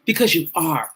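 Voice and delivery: low line with weight on the final "are"; stress on "are". Real, not acted. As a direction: lean firmly on the closing "are". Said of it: "I put the emphasis on the aura".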